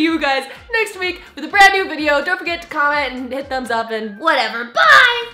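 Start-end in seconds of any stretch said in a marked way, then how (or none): 4.6-5.3 loud, high goodbye; cheerful shout. Real, not acted. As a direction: chirp it out. Cheery sign-off.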